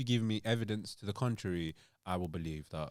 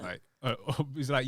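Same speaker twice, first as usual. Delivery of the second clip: ordinary talk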